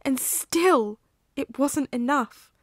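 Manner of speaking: rising from bitter to all-out shouting; furious scream at a friend; furious outburst. Acted. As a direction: hurl it at him, building to a yell. Remus rages at Sirius.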